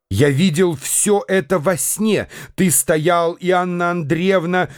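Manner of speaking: plain reading voice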